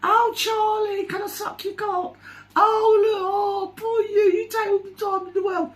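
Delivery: very high, drawn-out fawning falsetto; mock sycophant. Imitating sycophants fawning over Charlie, mocking high gush. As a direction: gush in a squeaky, simpering fan voice. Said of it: "when you see all the sycophantic".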